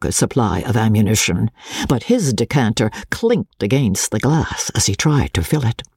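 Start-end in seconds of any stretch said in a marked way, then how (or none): none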